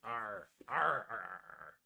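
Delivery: low, repeated pirate growls; pirate "arr". Pretend. Trying out pirate noises for pirate day, growled. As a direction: roll out playful arrs, dying away.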